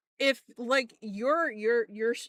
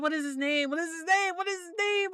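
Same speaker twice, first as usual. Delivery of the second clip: rising higher with each repeat; frantic recall; 6 semitones higher than usual. Real, not acted. Streamer blanking on a wrestler's name, increasingly frantic. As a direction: rack your brain, more desperate each time.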